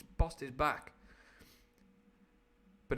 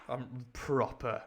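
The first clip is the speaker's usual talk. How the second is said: ordinary delivery, nothing marked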